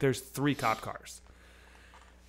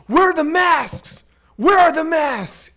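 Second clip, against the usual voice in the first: loud, high, slow interrogation; shouted demand; 9 semitones higher, 8 dB louder, slower than usual. Retold or imitated. Cops questioning cuffed teens on the curb, demanding. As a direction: imitate a cop hammering out each word.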